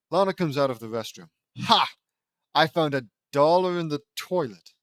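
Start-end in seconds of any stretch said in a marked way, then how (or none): 1.6-4.7 loud, crowing announcement; hammed-up loud triumph; triumphant boast. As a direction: burst out with a gloating find. Lana boasts of her find.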